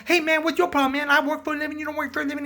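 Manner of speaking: very high, loud retort; hammed-up shouting match; mock quarrel. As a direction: argue back like an indignant host. Acts out host arguing back.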